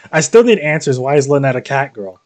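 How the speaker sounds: ordinary talk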